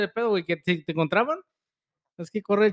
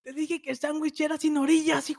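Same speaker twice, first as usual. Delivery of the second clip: complaining grandma voice; 8 semitones higher than usual. Acted.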